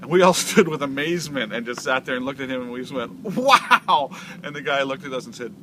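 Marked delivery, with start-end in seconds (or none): 3.2-4.1 high, loud astonished cry; amazed wow. Retold or imitated. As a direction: gasp it out in awe. Amazed at the knockout punch.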